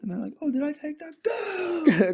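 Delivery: high commander voice holding back; high countdown voice. Pretend. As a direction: call hold like a drill officer. Countdown for the sneeze.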